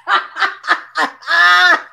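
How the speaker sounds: big loud cackle; hearty laugh; loud burst of laughter. Real, not acted. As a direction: let out a loud laugh at yourself. Laughing at her own comment.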